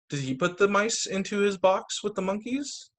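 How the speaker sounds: ordinary delivery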